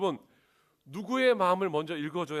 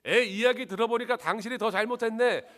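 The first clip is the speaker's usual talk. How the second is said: mock husband taking sides, higher and louder; hammed-up husband's wrong reply; blunt husband; 8 semitones higher, 8 dB louder than usual. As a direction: play the tactless husband judging his wife. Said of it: "대부분 남편들이 뭐라고 이야기합니까?".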